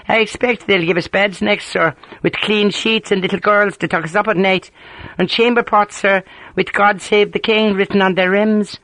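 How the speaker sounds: mock-earnest Ulster ribbing; amused Ulster accent. A narrator's reading. Sergeant teasing his grumbling officer, in amused Ulster accent. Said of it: "Harper's thick Ulster accent was rich with amusement".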